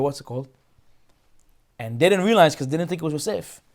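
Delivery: ordinary talk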